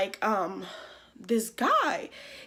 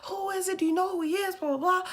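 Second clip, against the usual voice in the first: imitated scolding; 7 semitones higher than usual. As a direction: mimic a mother cussing out her child. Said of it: "She was cussing me out".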